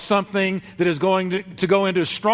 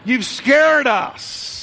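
much higher, louder, slow scolding shout; angry mother shout; 9 semitones higher, 5 dB louder, slower than usual. Retold or imitated. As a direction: yell at the lost son in fury. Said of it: "It was".

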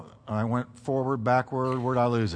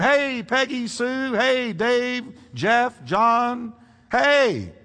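calling out names; 8 semitones higher, much slower than usual. Pretend. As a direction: call each sheep like a shepherd across the field. Shepherd calling sheep by name.

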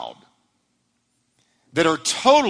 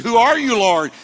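startled cry; 8 semitones higher, 7 dB louder than usual. Acted. Saul answers the voice.

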